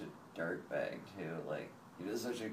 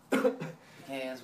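loud pecans; 4 semitones higher, 10 dB louder than usual. Shown as a demonstration.

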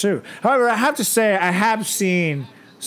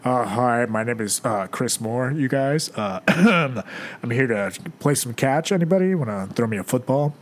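low, subdued macho-guy imitation; teasing low-voiced jock impression; mock straight guy; 5 semitones lower than usual. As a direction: play it overly straight and sporty. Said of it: "went the other way with it".

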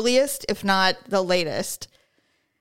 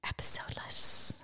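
whispered aside; 16 dB quieter than usual. Real, not acted. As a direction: breathe it out unvoiced.

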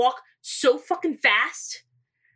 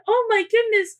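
higher, louder mock dismay; hammed-up gasp; 8 semitones higher, 4 dB louder than usual. Daughter hamming up guilt to dad on the phone, loud and high.

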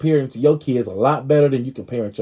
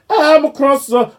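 much higher, slow, louder diva voice; 13 semitones higher, 7 dB louder, slower than usual. Retold or imitated. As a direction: play the diva, up high. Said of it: "She said".

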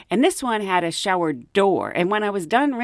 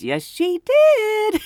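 mock clerk reply; 4 dB louder, much slower than usual.

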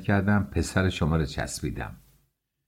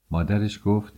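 plain reading voice

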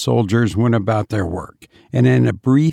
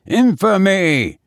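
much higher, slow outraged cry of Infamy!; indignant cry; 8 semitones higher than usual. A narrator's reading. A conspirator cries out against the captain, outraged. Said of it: "cried one who stood beside Gismondi".

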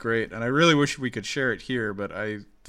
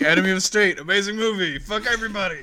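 loud high mock rant; 8 semitones higher, 6 dB louder than usual. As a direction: rant it like an angry fan. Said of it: "I just didn't expect you being like".

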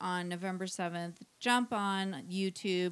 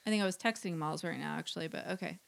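normal talking voice

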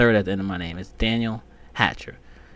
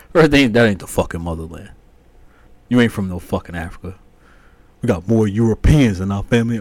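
louder, scornful imitation of brother; mocking brother; 6 dB louder than usual. Imitating his brother dismissing Africa, loud and scornful. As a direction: parrot him with scorn.

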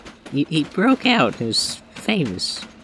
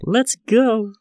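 higher, louder call to a friend; 7 semitones higher, 6 dB louder, much slower than usual. Acted. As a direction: shout it across the train car. Calling out to Trevor.

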